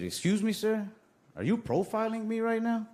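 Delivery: put-on high, polite-but-offended kid voice; indignant kid. [name] confronting the cashier in a high indignant voice. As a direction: put on a prim wounded boy.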